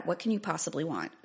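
ordinary delivery

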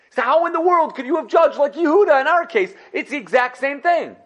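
indignant, laughing a little; indignant protest. Acted. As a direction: protest to the judge as if cheated. Levi protests to the judge.

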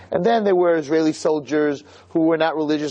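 ordinary delivery

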